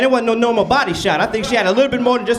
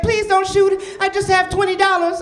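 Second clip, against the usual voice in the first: higher, pleading, mock scared; scared pizza man; 6 semitones higher than usual. Pizza man begging the robber, mock scared and high. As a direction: beg like a frightened delivery guy. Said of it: "He was like".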